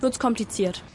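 ordinary talk, nothing marked

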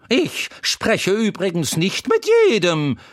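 put-on a chatty horse teasing the crying boy; Troubadour the talking horse. A narrator's reading. Horse Troubadour comforting the crying boy Wendel, high and loud.

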